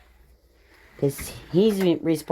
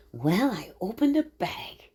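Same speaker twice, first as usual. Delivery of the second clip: 1 whisper; 4 dB quieter than usual. Real, not acted. Sneaking a look in the bag.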